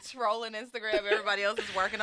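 ordinary talk

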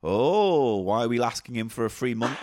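normal talking voice

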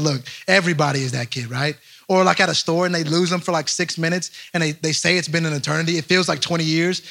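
normal talking voice

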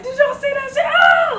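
high, loud disbelief ending in a yell; imitated excited fans. Reliving the crowd after the win, high excited yelling. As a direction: rave like a fan who just saw it.

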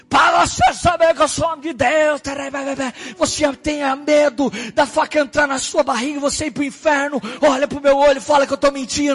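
shouted high, daring challenge; grito desafiador. Retold or imitated. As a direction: challenge him at full shout. Pregador desafia em voz alta. (grito alto e desafiador)

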